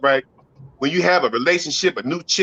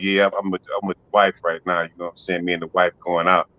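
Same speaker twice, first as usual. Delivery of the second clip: low mock husband; 13 semitones lower, 3 dB quieter than usual. Voicing a man on a call.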